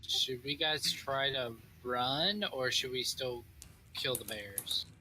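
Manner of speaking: ordinary talk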